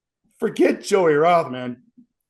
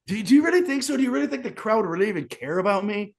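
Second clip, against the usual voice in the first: incredulous; 7 semitones higher, much faster than usual. Real, not acted. Doubts the crowd still cares.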